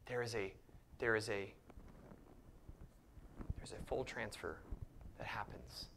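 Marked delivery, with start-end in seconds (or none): 3.6-5.9 hushed whisper to the congregation. Real, not acted. Preacher leaning in to his church, whispering. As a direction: drop to a whisper to draw them in.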